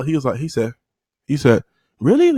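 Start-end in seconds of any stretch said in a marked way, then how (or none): 2.0-2.3 jumps high and louder in surprise; a kid hearing the news; imitating surprise. Giovanni surprised she likes him.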